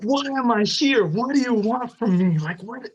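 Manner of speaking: high, loud, pleading shouts; desperate yell. Retold or imitated. Man yells his questions at God, loud and desperate. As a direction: hurl the questions upward, desperate. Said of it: "yelled and I said".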